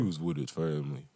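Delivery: low, quiet repeat of the line; low deep voice. Shown as a demonstration. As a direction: drop the voice way down. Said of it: "Is that how I talk?".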